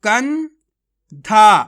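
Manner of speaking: कंधा said slowly and loud, syllable by syllable; teacher modelling कंधा slowly; slow demo. Shown as a demonstration. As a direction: spell out कंधा slowly for the class. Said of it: "चलिए एक बार फिर से देखिए".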